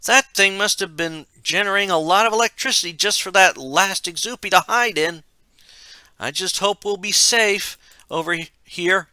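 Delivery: put-on high, loud young man's shout. A narrator's reading. Michael shouts about the overloaded generator as they run, high shout. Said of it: "he heard Michael shout".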